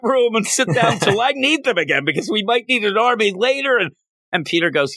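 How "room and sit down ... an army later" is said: high, urgent mock yell; Doc Ock's yelling. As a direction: imitate Doc Ock yelling orders in someone's ear. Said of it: "Doc Ock's yelling in Peter's ear".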